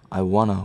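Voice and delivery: "wanna" blended and quick; teacher shows how "want to" is said in speech, as "wanna"; casual wanna. Shown as a demonstration. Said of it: "발음할 때는".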